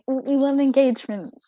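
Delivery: ordinary talk, nothing marked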